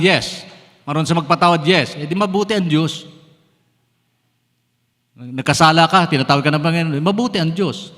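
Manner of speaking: loud, rising preacher's declaration; full-force preaching. Acted. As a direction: preach it hard to the congregation. (malakas na pangangaral)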